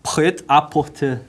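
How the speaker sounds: "prêt-à-porter" slow and careful, in French, pet-a-po-TÊ; "prêt-à-porter" said slowly with a French accent, dropped r's; French pronunciation. Shown as a demonstration. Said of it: "desculpa meu francês aqui".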